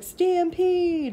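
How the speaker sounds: high shouted "Stampede!" like the film; hammed-up Jumanji shout; movie shout. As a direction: bellow a movie warning. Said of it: "He was like".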